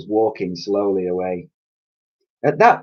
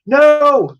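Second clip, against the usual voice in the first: very high loud cry; 9 dB louder than usual. A narrator's reading. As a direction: shout too late. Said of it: "the other two cried".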